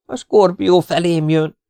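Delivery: slow, high, loud wail; frightened alarm. A narrator's reading. Partisan sees the scorpion coming at him, frightened wail.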